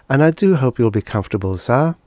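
fast, repeating like a machine; stuck robot voice. Acted. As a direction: loop the line like a jammed robot. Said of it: "she repeated".

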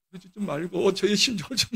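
normal talking voice

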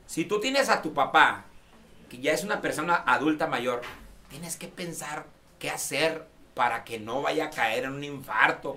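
lightly voiced serious lesson; closing message.

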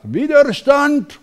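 put-on Mara Selvini Palazzoli, Italian accent. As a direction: belt it out in a thick Italian accent. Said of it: "spricht mit italienischem Akzent und sagt".

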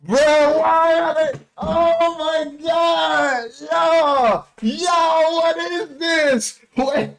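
high, slow disbelieving cries; stunned shouting. Real, not acted. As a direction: yell at the screen in disbelief. Yelling at the fight on screen.

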